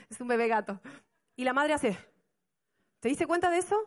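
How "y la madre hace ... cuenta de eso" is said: normal talking voice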